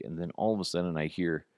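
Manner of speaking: ordinary talk, nothing marked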